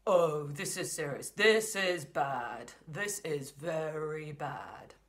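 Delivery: lightly voiced worried dad, very slow; dad pacing and fretting, drawn out; dad's low voice. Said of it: "So the dad's got the low voice".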